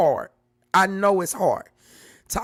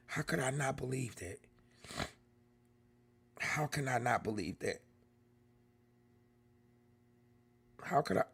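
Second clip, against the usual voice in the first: much softer, low, deflated; hushed disbelief; 7 semitones lower, 10 dB quieter than usual. Real, not acted. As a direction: mutter it to yourself in disbelief. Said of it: "laughing to keep from crying".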